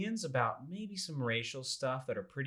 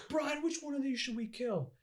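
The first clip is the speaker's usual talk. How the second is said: mock threat. Kidnapper threatening.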